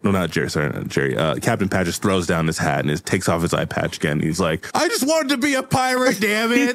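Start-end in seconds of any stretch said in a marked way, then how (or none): 4.7-6.7 high-pitched frustrated outburst; hammed-up whiny meltdown; pirate tantrum. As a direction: wail it like a thwarted child. Captain's tantrum after being beaten.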